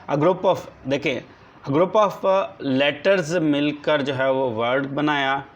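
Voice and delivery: ordinary delivery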